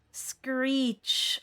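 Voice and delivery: ordinary talk, nothing marked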